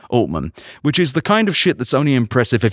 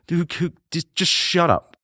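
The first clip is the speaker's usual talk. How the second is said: higher-pitched fed-up sputter; exasperated; 7 semitones higher, 4 dB quieter than usual. Real, not acted. Podcast host telling off a boastful CEO, exasperated.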